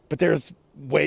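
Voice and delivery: usual speaking voice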